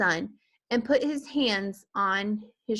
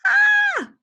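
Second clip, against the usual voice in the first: piercing high scream; mother screaming; terrified scream; 10 dB louder than usual. A narrator's reading. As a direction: scream in terror.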